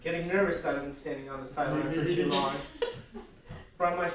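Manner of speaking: soft, low deadpan thoughts; inner monologue. Acted. Nervous improviser thinking on the sideline, hushed inner monologue. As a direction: think aloud, low and quiet.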